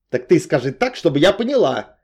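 fed up, loud and high; angry, pushing for an answer; growled. A narrator's reading. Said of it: "прорычала Наташка".

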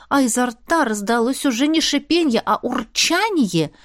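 ordinary delivery, nothing marked

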